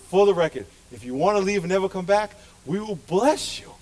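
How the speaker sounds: ordinary delivery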